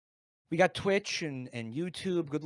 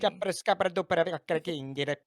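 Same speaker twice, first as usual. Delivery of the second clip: fake Italian; 3 dB louder than usual. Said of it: "keep cutting over, and the Italian".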